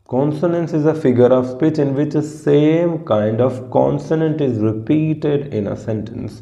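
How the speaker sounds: very slow, defining word by word; slow read-aloud of a definition; slow dictation. Shown as a demonstration. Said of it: "reads definition aloud".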